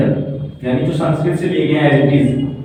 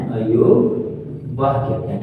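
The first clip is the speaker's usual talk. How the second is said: अयोगवाह drawn out slowly and softly; slow, soft teacher's repetition; slow repeat; 3 semitones lower, 4 dB quieter, much slower than usual. Shown as a demonstration.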